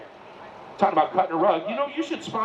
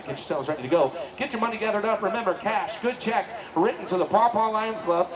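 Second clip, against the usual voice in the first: usual speaking voice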